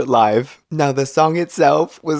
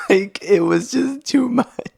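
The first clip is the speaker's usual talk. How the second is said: high, squeaky voice through laughter; real giggly squeal of amusement; laughing; 11 semitones higher than usual. Real, not acted. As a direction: barely get it out for laughing. Laughing at recalled stifled laughter.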